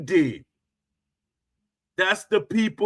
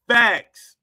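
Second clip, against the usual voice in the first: loud, clipped declaration; emphatic punchline; 5 dB louder than usual. Retold or imitated. Hammering each boast with a loud mock "Facts". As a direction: slam the word down. Punctuating each boxing claim.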